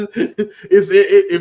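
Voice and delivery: stammering through a real laugh; high, loud, laughing restart. Real, not acted. Laughs while trying to continue.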